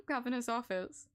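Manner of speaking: normal talking voice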